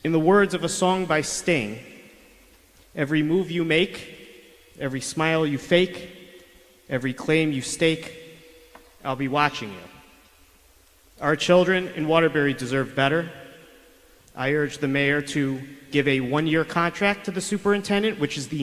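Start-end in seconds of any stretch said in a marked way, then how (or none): none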